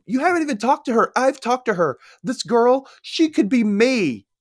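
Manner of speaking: high-pitched upset plea, slowing at the end; pleading teen. Retold or imitated. Girl pleading with her friend, high and upset.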